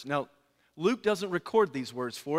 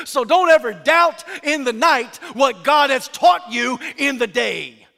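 louder, higher, building preached crescendo; preaching at full force; 7 semitones higher, 9 dB louder than usual. Acted. Preacher to the congregation, rising loud proclamation. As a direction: build to a ringing climax.

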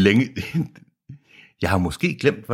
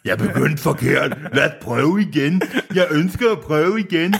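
hammed-up sensual voice, pitched higher; mock sensual; 9 semitones higher than usual. Shown as a demonstration. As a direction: play it as over-the-top seduction. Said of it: "jeg prøver at være lidt fucking sensuel". (overdrevet sensuel stemme, højere i tonen)

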